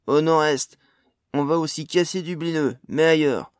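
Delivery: loud, assertive cut-in; bold interruption. A narrator's reading. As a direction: cut across and answer boldly. Jasion cuts in over companion.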